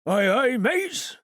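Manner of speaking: a jolly sea cook's reply; Long John agreeing. Acted. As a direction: answer the crew cheerfully.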